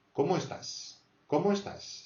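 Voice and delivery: Comment pitched high, estás falling; high accent on Comment. Shown as a demonstration. Teacher modelling "how are you", Comment rising high. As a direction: lift Comment as a question. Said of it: "C'est une question, accent".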